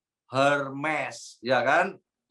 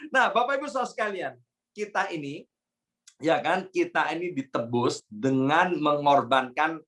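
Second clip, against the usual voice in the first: ordinary delivery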